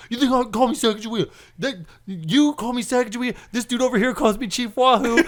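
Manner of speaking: high-pitched mock outrage; indignant yelling. Insulted coworker confronting the storyteller, indignant yelling. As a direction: rant it high, deeply offended. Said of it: "he starts yelling at me".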